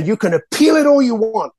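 imitated Australian accent, pitched high. Director defying the artist to appeal, high-pitched Australian accent. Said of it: "in her high-pitched Australian accent".

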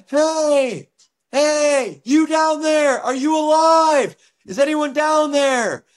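high, slow calls down the slope; calling out. Retold or imitated. Stranger on the road calls down looking for survivors, high slow calls. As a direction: hail into the snow, searching for survivors.

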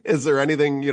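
high burst of laughter; laugh, then speak through it. Real, not acted. Host laughs at fly joke.

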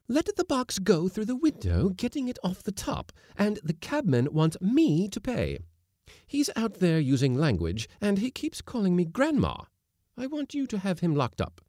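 high-pitched indignant woman's voice; complaining lady. Acted. Lady cook to skipper about the cabman, indignant. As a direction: pour out the grievance, pitched well up.

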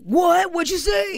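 high, loud mock challenge; imagined son at the races, loudly squaring up; mock confrontation. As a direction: bark it like picking a fight. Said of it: "It's not a, like".

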